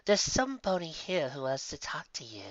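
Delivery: a lightly voiced coworker; Lotus voice. Lotus calls Sandalwood to the lobby, lightly voiced. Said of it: "called out Lotus".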